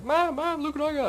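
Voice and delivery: put-on high, thrilled child calling mom; excited kid. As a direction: squeal it like an excited twelve-year-old. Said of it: "I was so excited".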